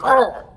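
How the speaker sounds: sudden high loud "Argh!" as he jams it in; effort grunt. Pretend. Showing the viewers the jab with a loud grunt. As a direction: grunt with the force of the shove. Acting out the fish hook jab.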